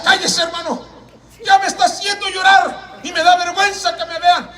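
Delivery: high, strained imitation of a man starting to cry; mock-tearful protest; retold tearful plea. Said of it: "y empezó a llorar".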